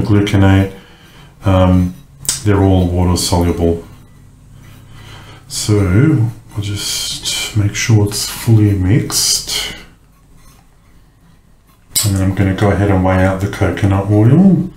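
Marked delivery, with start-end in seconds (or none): none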